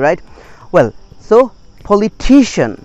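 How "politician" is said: "politician" with TI punched high; correct stress. Shown as a demonstration. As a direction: punch TI in "politician", fall on "shun". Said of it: "to pronounce correctly".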